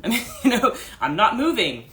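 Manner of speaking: amused laugh at the joke on herself. Real, not acted. Laughing over her new name, real laughter.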